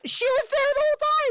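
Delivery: very high, loud protest; exasperated shout. Real, not acted. Game master corrects forgetful players, exasperated high shout. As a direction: shout it in real disbelief.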